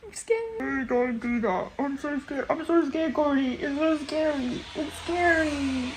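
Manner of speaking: high, whimpering frightened plea; scared. Real, not acted. Reader begging Cody in a high scared voice. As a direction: whine it out, terrified.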